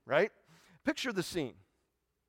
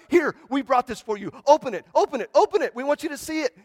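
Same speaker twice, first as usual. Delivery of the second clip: much higher and louder, eager and bubbling; excited gift-giving; 9 semitones higher, 10 dB louder than usual. Retold or imitated. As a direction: urge him to open it like eager kids. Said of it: "instead of being quiet, they're like".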